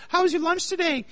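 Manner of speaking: a hint of a cheerful mother; mom asking. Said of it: "his mom's like".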